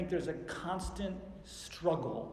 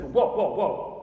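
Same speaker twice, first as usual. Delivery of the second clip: high, very loud indignant stop; angry halting shout; 4 semitones higher, 12 dB louder, slower than usual. Acted. Jesus angry with his disciples, indignant shout. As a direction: shout them down in anger. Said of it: "I think he was angry with his disciples".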